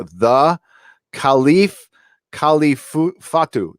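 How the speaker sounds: slow, careful name reading; careful unhurried voice. Shown as a demonstration. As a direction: sound out the name slowly. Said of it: "let me say this slowly now".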